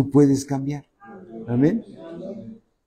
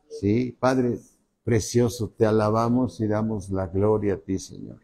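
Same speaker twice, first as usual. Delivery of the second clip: prayer; 5 semitones lower than usual. Acted. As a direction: pray humbly to the Father.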